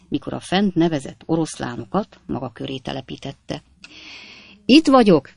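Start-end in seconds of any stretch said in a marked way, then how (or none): 4.7-5.3 a raised, higher shout from the far corner. A narrator's reading. Editor calling to the hostess from the far corner, loud shout. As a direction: call out high and loud across the hall. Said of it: "kiáltotta a szerkesztő".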